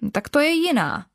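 high exclamation; exclaiming happily. Acted. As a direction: call it out, pleased and lifted. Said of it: "zvolal Sosálek".

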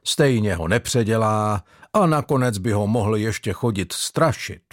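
ordinary delivery, nothing marked

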